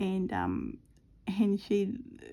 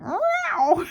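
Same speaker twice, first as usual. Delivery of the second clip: high, loud startled meow; imitated cat yowl; 12 semitones higher, 6 dB louder than usual. Cat has lino ripped off, yowling meow. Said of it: "Misty went".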